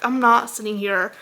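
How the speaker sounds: ordinary delivery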